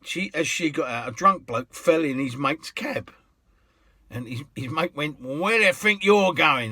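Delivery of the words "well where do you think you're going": louder, higher indignant cabbie; indignant challenge. Retold or imitated. Cabbie challenging a drunk who fell into his cab, loud. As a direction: call him out sharply.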